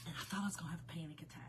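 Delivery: fast breathless whisper. Real, not acted. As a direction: hush it out quickly like a secret confession. Retelling panic at night.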